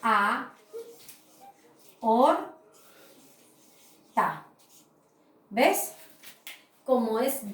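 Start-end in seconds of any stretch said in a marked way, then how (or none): 0.0-4.4 aorta split into A, or, ta with long pauses; syllabified slowly. Shown as a demonstration. Teacher separating aorta into syllables on the board. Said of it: "aquí a un ladito la voy a separar".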